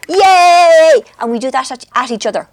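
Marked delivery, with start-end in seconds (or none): 0.1-1.0 loud, high, sustained yay; answering happy squeal. Retold or imitated. Aunt copying her niece in their game, loud high squeal. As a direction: call it back long and high. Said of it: "And then I go".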